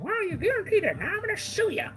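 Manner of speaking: hammed-up high voice of a commenter threatening to sue; mocking whiny critic. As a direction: squeak it like an offended internet troll. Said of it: "People are gonna be like".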